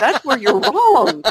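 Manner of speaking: mock-firm correction, laughing; laughing comeback. Real, not acted. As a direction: chuckle through a playful correction.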